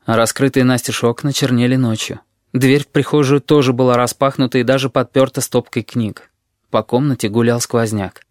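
ordinary delivery, nothing marked

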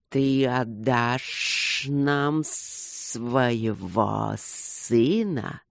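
low, very slow serpent voice; hissing serpent. A narrator's reading. Serpent demands a price from Luke, slow hiss. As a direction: stretch every word into a hiss.

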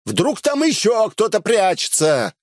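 high-pitched mocking-warning call; shouting after someone. A narrator's reading. Hero warning Elsa as she rides off, shouting. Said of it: "закричал я ей вслед".

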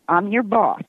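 ordinary talk, nothing marked

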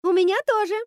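very high, loud happy shout; calling back. A narrator's reading. Princess answers the witch below, calling back. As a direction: call it back high and eager.

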